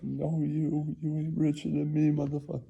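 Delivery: high-pitched put-down; mock billionaire trash talk; mock rich rival. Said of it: "And I was like".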